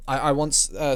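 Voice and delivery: usual speaking voice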